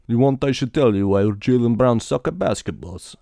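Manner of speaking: hammy fake Russian accent on a phone call; thick Russian accent. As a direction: play a Putin troll in a thick Russian accent. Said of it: "a thick Russian accent".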